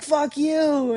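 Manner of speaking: high, loud imitation of a friend; playful yell back. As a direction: holler the jokey insult back. Friend yells back in fun.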